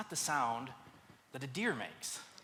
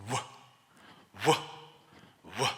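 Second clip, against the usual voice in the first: short huffed animal grunts, spaced out; imitated bear grunt; 4 semitones lower than usual. Retelling the bear noise behind the tree, huffed grunts. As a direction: mimic the bear's low huffs one at a time. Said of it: "he starts making this noise".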